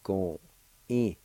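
"go" and "e" set apart; syllable by syllable. Shown as a demonstration. Teacher showing second part of flaxseed, syllable by syllable. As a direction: sound out go, pause, e. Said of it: "Second part".

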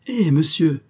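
high-pitched call to his master; crying out. A narrator's reading. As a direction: call out in mild alarm. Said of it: "Un second cri retentit plus perçant".

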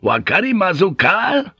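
loud, high, forceful question; shouted demand. Acted. Pilot kicking the door open before samurai, shouted demand. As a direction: bellow it as an ultimatum.

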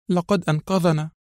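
high, lightly choked voice. A narrator's reading. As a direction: answer as if holding back tears. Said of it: "وهي تجيب بصوت مختنق".

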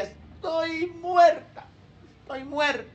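hammed-up weary groan, slow; mock whine of exhaustion; mock exhausted. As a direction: drag out estoy muerta like a tired churchgoer. Mocking worn-out churchgoer.

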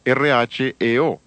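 R H E O spelled letter by letter, slowly; spelling out letters. Shown as a demonstration. Preacher spells the Greek word for the congregation, slow letter by letter. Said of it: "Es la palabra griega reo".

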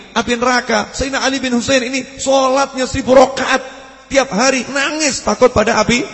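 loud, high preaching at full force; fiery preaching. Acted. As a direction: thunder against the slander from the pulpit. Preacher rebuts slander at full force. (ceramah berapi-api, keras dan tinggi)

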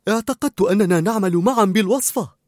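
ordinary delivery

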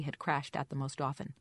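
ordinary delivery, nothing marked

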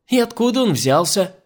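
high, loud irritated question; angry. A narrator's reading. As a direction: demand it crossly. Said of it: "злилась маменька". (сердитый вопрос высоким голосом)

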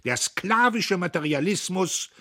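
loud, high public oration; the old man denounces before the crowd; loud, high speech of a warning elder; impassioned old orator. A narrator's reading. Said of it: "rief der Alte".

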